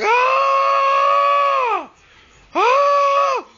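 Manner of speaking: high, loud shrieks; screaming in pain. Retold or imitated. Boy shrieking as the ghost bites his leg. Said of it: "I'm like".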